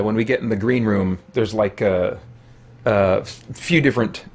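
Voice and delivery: ordinary delivery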